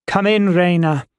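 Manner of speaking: high, terse call to come in; curt, annoyed. A narrator's reading. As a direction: call out as if bothered by the knocking. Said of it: "said curtly, as if annoyed".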